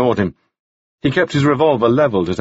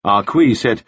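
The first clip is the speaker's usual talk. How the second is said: usual speaking voice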